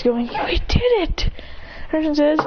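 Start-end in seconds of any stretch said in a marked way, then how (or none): 0.3-1.3 high, slow cry of victory; tearful joy. Acted. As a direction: celebrate high-pitched, as if through happy tears. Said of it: "Tess smiles with tears".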